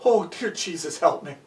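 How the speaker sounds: low, hushed plea to himself; soft, private prayer under pressure; silent prayer. Acted. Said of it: "Walter thought".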